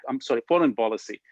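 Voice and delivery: normal talking voice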